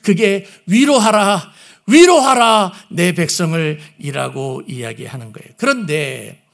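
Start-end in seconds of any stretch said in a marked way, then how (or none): 0.6-3.8 위로하라 twice, loud and raised; double emphasis. Shown as a demonstration. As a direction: press 위로하라 hard both times. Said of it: "더블로 위로하시는 거예요".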